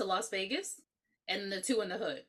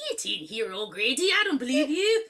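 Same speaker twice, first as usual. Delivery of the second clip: hammed-up, failing Irish accent; 3 semitones higher, 4 dB louder than usual. Host as the wife doubting O'Grady, bad Irish accent. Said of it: "but an Irish accent".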